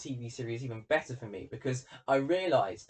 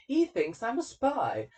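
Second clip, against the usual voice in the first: much higher, slow, drawn-out answer; lightly acted interviewee, pitched up and slowed down; Elliot; 10 semitones higher, 4 dB louder, slower than usual. Elliot telling the Colonel about his father, high slow reply. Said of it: "And it says".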